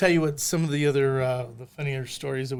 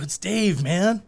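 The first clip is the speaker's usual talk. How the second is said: [name] stoner; 4 semitones higher, 4 dB louder, slower than usual. Retold or imitated. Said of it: "Like the old [name] bit".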